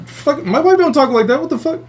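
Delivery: high-pitched disbelief, not shouted; retold incredulous alarm. Husband doubting his dream wife, retold high incredulous reaction. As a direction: act out baffled alarm, voice jumping up high.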